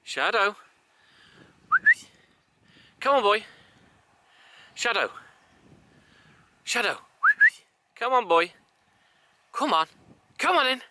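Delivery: very high, sing-out recall call; calling a dog. Real, not acted. Owner calling Shadow to come on, high dog call.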